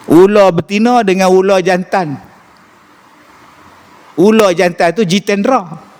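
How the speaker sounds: ordinary talk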